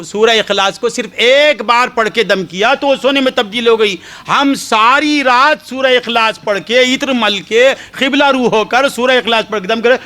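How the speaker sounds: high, aggrieved complaint building up; complaining woman. Retold or imitated. Woman complaining to the saint about the soil, high and aggrieved. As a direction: pour out the grievance.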